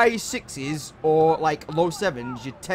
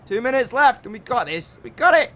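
excited; 7 semitones higher, 6 dB louder than usual. Real, not acted.

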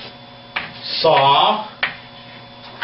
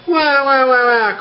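high wah-wah wail, sliding down; mock crying; 6 semitones higher than usual. Shown as a demonstration. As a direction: act out a baby-like wail.

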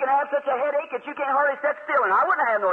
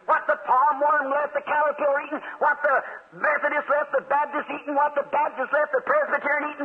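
ordinary delivery

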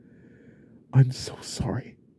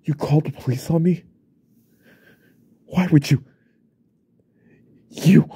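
rising, louder angry accusation; angry shout of betrayal; betrayed outburst; 3 semitones higher, 6 dB louder than usual. Acted. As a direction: build from disbelief to a shout. Wife called the police.

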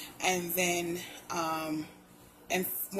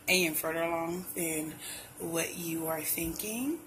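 ordinary talk

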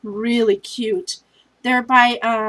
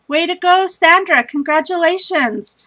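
higher and louder, cheering her on; excited congratulations; 6 semitones higher, 4 dB louder than usual. Real, not acted. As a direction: cheer the winner by name. Congratulating the prize winner.

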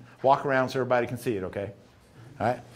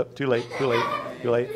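normal talking voice

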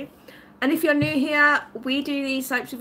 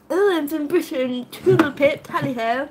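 mock posh British; 2 semitones higher than usual. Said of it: "that is the standard go-to".

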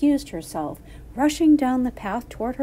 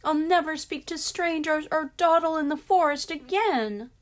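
put-on little girl; 8 semitones higher, 3 dB louder than usual. Little girl promises Grandma.

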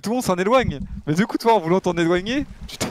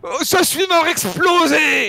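mock dying wail; 8 semitones higher, 3 dB louder, slower than usual. Real, not acted. Dying in game, exaggerated.

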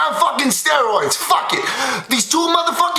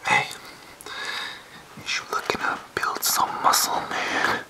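soft, sneaky whisper; hushed, conspiratorial pitch; 10 dB quieter than usual. Pretend. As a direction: whisper it like a shady gym dealer. Whispered sales pitch joke.

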